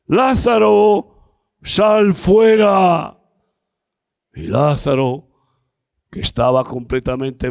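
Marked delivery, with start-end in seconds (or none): 0.1-3.2 slow, high, loud summons; loud call. Acted. Jesus calling Lazarus from the tomb, a slow loud cry. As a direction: call the dead man out, stretched and loud. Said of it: "Y gritó".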